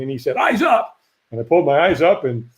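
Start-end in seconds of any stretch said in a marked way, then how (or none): 0.4-1.0 high-pitched barked command; mock yell, pitched well up; imitated trainer's scream. Firewalk trainer ordering a walker to look up, imitated yell. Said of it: "he screamed at me".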